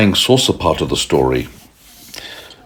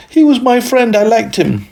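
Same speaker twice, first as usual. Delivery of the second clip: put-on upset little girl. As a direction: wail at her brother in dismay. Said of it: "She was so upset".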